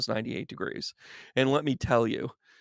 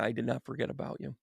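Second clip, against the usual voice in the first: ordinary talk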